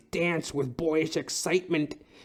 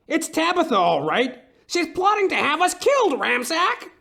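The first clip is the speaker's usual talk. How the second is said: high, louder alarmed outburst; 13 semitones higher, 4 dB louder than usual. Acted. Client telling the detective, rising alarm. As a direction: blurt out the fear, pitch jumping up. Client panics about wife's plot.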